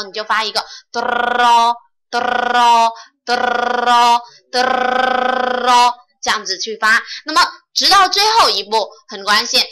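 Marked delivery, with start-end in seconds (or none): 0.0-4.2 咯咯咯 as a long trilled rolled r. Shown as a demonstration. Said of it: "把这个咯的音拉长了之后".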